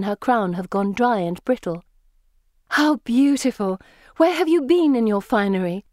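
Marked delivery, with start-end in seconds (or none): 2.7-5.9 put-on higher, eager girlish voice; light voiced gushing girl; gushing Pippa. A narrator's reading. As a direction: gush with delight.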